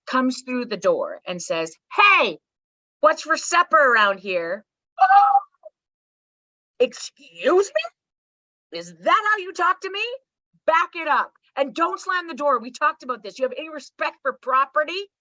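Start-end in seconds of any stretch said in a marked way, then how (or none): 2.0-4.7 put-on loud, high "Hey" from a kid barging in; demanding child. Acts own child barging in. 5.0-15.1 put-on high, affronted scolding of own child; indignant mother.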